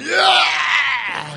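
a loud, high mock roar; lion roar. Preacher mimicking a mighty lion on its throne, mock roar. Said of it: "sitting on that throne going".

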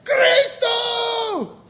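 high, drawn-out shouted response; congregation's shouted answer. Retold or imitated. Imitating everybody answering the church call, shouted. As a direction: shout back as a whole crowd would. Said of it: "And everybody goes".

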